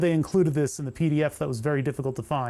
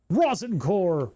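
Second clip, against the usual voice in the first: announcer-style repeat; 3 semitones higher, slower than usual. Shown as a demonstration. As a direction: say "Rosin core!" slowly, drawn out like an ad tagline.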